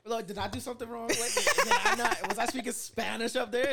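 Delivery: high, loud, quick disbelief; mock bewilderment. Cohost mocking preacher ignored by audience, loud disbelief. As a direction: act baffled nobody listened.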